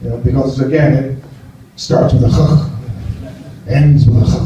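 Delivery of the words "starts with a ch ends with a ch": each "ch" a long, voiceless throat scrape; comically stretched throaty "ch"; guttural Dutch ch. Shown as a demonstration. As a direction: drag out and rasp both "ch" sounds. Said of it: "it's got that ch".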